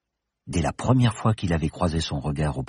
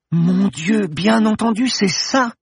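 sudden realization; 14 semitones higher, 4 dB louder, slower than usual. Acted.

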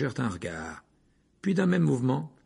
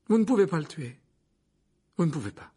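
raised, insistent plea; urgent protest; 6 semitones higher, 4 dB louder than usual. Acted. Harry shields Pettigrew from the wands, urgently. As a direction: plead urgently to stop them.

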